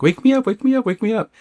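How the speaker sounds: high-pitched, small-scale pretend scream; mock screaming. Dreamer begging to be woken, high mock scream. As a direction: squeal it like a held-back scream. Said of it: "she starts screaming".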